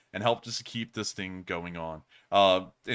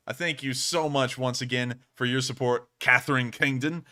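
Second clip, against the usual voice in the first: ordinary delivery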